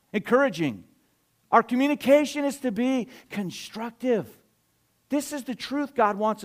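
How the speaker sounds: emphatic, high-energy sermon delivery; forceful preaching. Real, not acted. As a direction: proclaim each phrase with weight and pauses.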